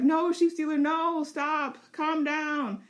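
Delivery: high, loud pleading with the dragon; high loud plea to stop; pleading rider. Pretend. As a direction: try to calm the raging dragon. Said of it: "And she's like".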